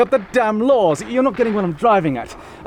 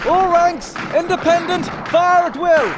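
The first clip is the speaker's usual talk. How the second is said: commanding shout; 6 semitones higher than usual. Acted. Officer ordering all ranks to fire, shouted. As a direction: call it out across the battlefield.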